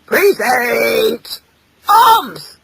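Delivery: drawn-out drill command leaping high at the end; loud parade-ground bark; barked order. Acted. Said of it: "barked his father".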